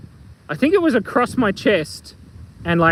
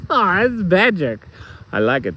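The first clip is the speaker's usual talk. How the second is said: usual speaking voice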